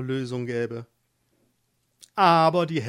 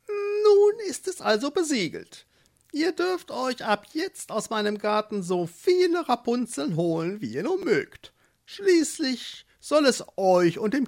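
much higher, gloating witch; satisfied witch; 9 semitones higher than usual. Acted. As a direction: savor the victory. Witch seals the deal.

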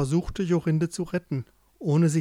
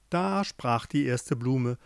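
ordinary delivery, nothing marked

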